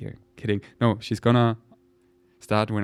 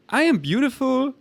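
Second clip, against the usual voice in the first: high giddy voice; 6 semitones higher, 3 dB louder than usual. Retold or imitated. Coffee high voice quote.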